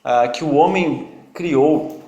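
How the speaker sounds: normal talking voice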